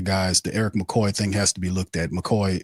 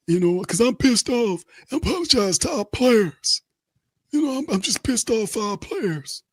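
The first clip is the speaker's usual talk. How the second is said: squeaky high falsetto coach at a press conference; mock the coach, high and whiny; a press-conference parody of the head coach; Coach Rizzi impression. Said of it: "head coach".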